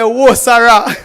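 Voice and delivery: loud exclaimed Whoa, Sarah; Patois exclamation. Retold or imitated. Poet showing Patois reaction, loud exclamation. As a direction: belt it out in Patois. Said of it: "we say".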